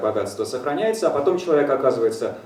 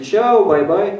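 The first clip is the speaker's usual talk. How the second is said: breezy goodbye; 9 semitones higher, 7 dB louder, much slower than usual. Retold or imitated. Breezy farewell.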